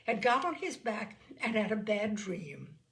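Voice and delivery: ordinary delivery, nothing marked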